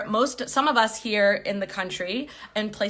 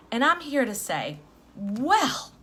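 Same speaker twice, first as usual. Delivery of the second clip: ordinary talk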